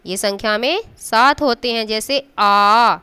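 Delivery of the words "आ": आ stretched long and slow; drawn-out vowel. Shown as a demonstration. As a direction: hold the vowel आ long, as a teacher shows. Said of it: "लेकिन आ देर से बोला जा रहा है".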